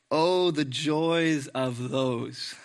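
very slow, lingering reading; slow reverent reading; savoring the words. Shown as a demonstration. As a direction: draw out each word like a blessing. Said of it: "That's beautiful".

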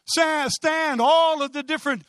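high, forceful preacher's call; ringing summons. Acted. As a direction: call them all out to stand. Calls philosophies to stand.

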